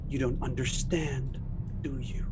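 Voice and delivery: creepy suspect. Acted. Suspect mocking the detective in a slow, soft taunt. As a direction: taunt the detective gently and slowly.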